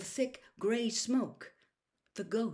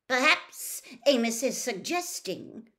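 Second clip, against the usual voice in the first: raised, deliberate, measured menace; cold anger; 6 semitones higher, 4 dB louder than usual. A narrator's reading. Senior official challenges his colleague, coldly angry. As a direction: weigh each syllable icily. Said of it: "cold anger in every syllable".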